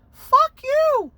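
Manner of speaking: put-on very high, loud drunken screech; drunk yell at cops. Rhoda screaming at the cops, high screech. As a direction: scream it at the cops like a wasted idiot.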